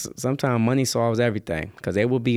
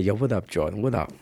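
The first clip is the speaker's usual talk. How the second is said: low, much softer mock street greeting; a lowered hint of a tough guy; 6 semitones lower, 5 dB quieter than usual. Imitating the [name] lookalike.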